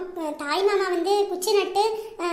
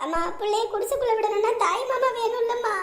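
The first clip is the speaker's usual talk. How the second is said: sobbing, broken pleading; distraught daughter weeping to her mother; crying. Acted. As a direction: beg helplessly through tears. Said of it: "எம்மாடி, அழாத".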